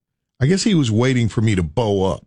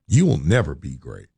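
lower, final verdict; 6 semitones lower than usual. Retold or imitated. Boss's verdict echoed, lower and heavier. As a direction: drop lower and let it fall like a door closing.

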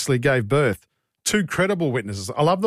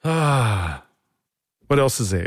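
ordinary delivery

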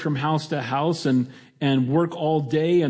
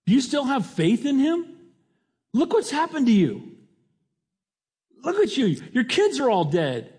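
much higher, scornful, incredulous wife; taunting wife; 9 semitones higher than usual. Retold or imitated. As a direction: needle him from way up high, mocking his faith. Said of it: "Here's what she's saying".

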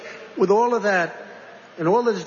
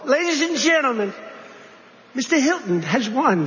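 mock announcer; 5 semitones higher than usual. Mock official announcing to the public, lightly hammed. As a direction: proclaim the winner like a mock official.

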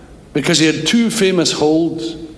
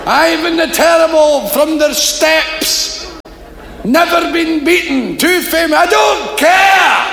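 high, loud shouted exchange; loud Glasgow circus shouting; 13 semitones higher, 3 dB louder than usual. Acted. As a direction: bellow across the tent.

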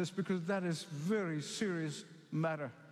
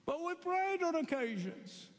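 much higher, begging tone; pleading foolish ones; 8 semitones higher than usual. Acted. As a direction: plead desperately at the closed door.

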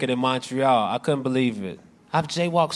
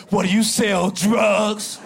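higher, slow mock-upset outburst; upset cop; 3 semitones higher, much slower than usual. Upset cop learning a jaywalker's income, slow indignant outburst. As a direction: drag it out as an affronted cop. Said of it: "And he got upset".

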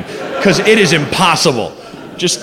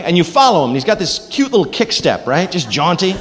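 usual speaking voice

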